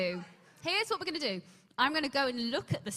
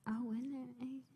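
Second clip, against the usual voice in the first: whispered to self; 3 semitones higher, 12 dB quieter, slower than usual. Real, not acted. Trying to recall line.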